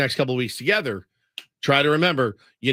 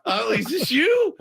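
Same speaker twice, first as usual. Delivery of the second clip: high, incredulous squawk; hammed-up disbelief with a laugh; retold bafflement; 13 semitones higher, 4 dB louder than usual. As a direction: act stunned, voice pitched way up. Retells baffled reaction to friend's accent.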